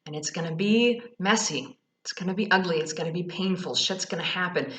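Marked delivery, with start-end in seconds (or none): none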